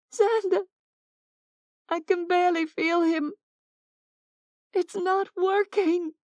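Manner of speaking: high, desperate voice; panicked plea. Acted. Oksana tells Xander Mason is slipping away, high panic. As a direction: cry out in rising panic, pitched high.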